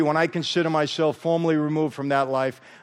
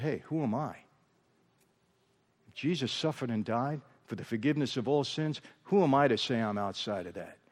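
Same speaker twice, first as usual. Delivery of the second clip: much softer, humbled self-question; hushed realization; 3 semitones lower, 9 dB quieter than usual. Retold or imitated. Humbled mob man asking himself, hushed. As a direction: let it drop to a stunned hush.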